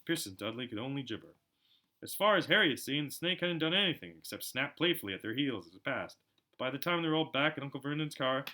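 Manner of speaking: ordinary delivery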